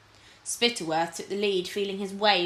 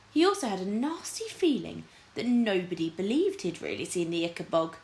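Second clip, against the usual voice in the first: normal talking voice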